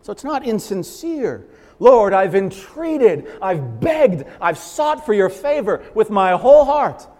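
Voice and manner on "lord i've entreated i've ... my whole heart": slow, loud, earnest plea; loud, slow entreaty to the Lord; fervent pleading prayer. Acted. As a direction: beg the Lord with your whole heart.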